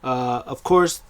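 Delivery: ordinary delivery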